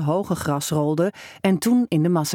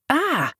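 startled cry; 8 semitones higher than usual. A narrator's reading. Boy startled by the scare.

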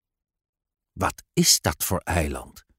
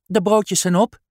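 put-on high, cheeky child voice; a girl who just ate the last roll; cheeky little girl; 10 semitones higher, 3 dB louder than usual. Girl Ellie answers father. (hoog, brutaal meisjesstemmetje)

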